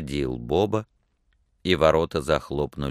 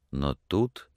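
ordinary delivery

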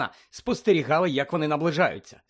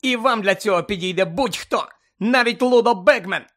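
high, heated accusation building louder; furious tirade; 5 semitones higher, 5 dB louder than usual. A narrator's reading. Hermione denounces the reporter, furiously. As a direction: build the anger to a loud high finish. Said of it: "люто проказала вона".

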